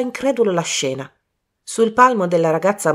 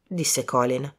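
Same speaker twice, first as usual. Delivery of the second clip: plain reading voice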